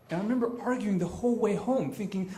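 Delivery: ordinary talk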